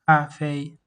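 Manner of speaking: "Cafe" loud, high and level on both syllables; high-pitched tones. Shown as a demonstration. Teacher repeating after the rule, "Cafe" high and level. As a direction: keep "Cafe" up without any drop. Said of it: "high-pitched tones".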